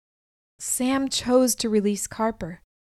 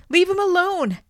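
high, loud desperate shout; 9 semitones higher, 5 dB louder than usual. Acted. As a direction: yell it out to stop the fight. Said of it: "I shouted".

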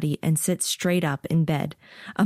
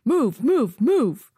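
much higher, loud soldier holler; 7 semitones higher, 5 dB louder, much slower than usual. A narrator's reading. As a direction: bark it across the yard. Soldier herding recruits.

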